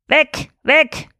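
high, sharp doggy yap; yapping old dog. A narrator's reading. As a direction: bark it out like an old chained dog. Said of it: "kläffte der alte Kettenhund".

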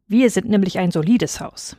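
ordinary delivery, nothing marked

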